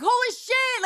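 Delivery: sudden high yell of pain; pained scream. Retold or imitated. In the car, screaming at each bump in pain. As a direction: shout it like every bump hurts. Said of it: "I would scream in the car".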